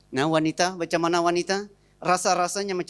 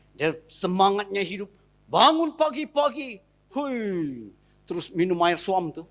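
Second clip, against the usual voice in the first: normal talking voice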